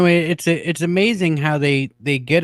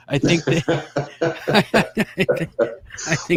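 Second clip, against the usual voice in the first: laughing; 3 semitones higher than usual. Real, not acted. Laughing at his own joke.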